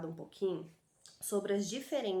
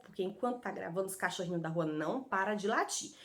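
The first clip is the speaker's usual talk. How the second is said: ordinary talk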